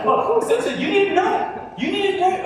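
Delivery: high, loud, urgent insistence; excited insistence. Real, not acted. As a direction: lean in, drive the point home with energy. Excited emphatic preaching point.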